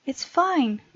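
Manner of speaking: usual speaking voice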